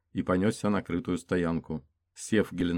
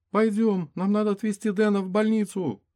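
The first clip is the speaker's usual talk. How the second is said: much higher, louder urgent plea; urgent female voice; 5 dB louder than usual. Acted. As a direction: hurry her away. Urgent order to leave.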